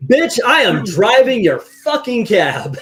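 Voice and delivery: loud, high, drawn-out indignation; mock outraged retort. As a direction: throw it out loud and slow, exasperated for comic effect. Acted indignant retort to cab passengers.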